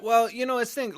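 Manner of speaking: usual speaking voice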